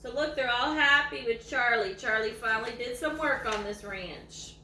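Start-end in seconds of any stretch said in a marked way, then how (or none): none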